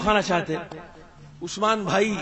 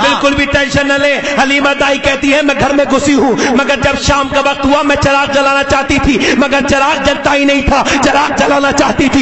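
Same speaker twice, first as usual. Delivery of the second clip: steady, projected sermon narration; insistent pulpit delivery; forceful preaching; 5 semitones higher, 9 dB louder than usual. Real, not acted. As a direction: tell it loudly to a whole congregation.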